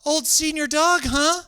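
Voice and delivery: prying, mock-concerned high voice; nosy stranger. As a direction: lean in like a nosy stranger at the dog. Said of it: "they just walk up and go".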